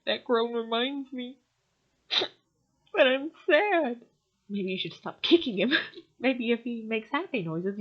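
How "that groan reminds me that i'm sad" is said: higher, louder, laughing through a mock lament; slow laughing mock sorrow; mock poet, laughing. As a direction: parody the sad rider while laughing. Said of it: "and he says".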